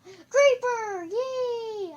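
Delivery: very high, loud cheer; excited squeal. Real, not acted. Gamer greeting a beloved creeper, high excited squeal. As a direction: cheer it at the top of your voice.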